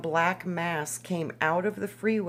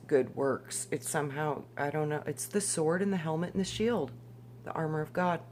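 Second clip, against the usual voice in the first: ordinary talk, nothing marked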